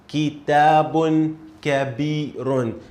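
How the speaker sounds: kitabun kabirun, slow and clear; slow model reading. Shown as a demonstration. Teacher reads the phrase slowly for a student. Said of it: "This is how we read this phrase".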